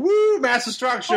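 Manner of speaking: high hooting walrus cry. Retold or imitated. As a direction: hoot it out like a silly walrus. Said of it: "going around going".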